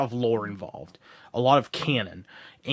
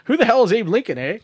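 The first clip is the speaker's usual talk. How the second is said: higher-pitched mock Canadian accent; Canadian friend; 8 semitones higher, 3 dB louder than usual. Teasing his Canadian friend about Lincoln, mock Canadian accent. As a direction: play up a puzzled Canadian buddy's accent. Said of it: "My Canadian friend Matt will be like".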